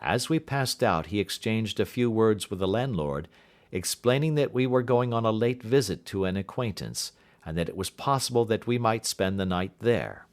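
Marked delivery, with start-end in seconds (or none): none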